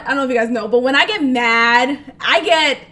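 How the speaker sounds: ordinary talk, nothing marked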